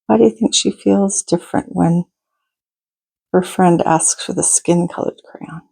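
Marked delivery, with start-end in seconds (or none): none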